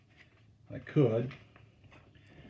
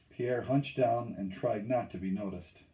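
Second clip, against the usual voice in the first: usual speaking voice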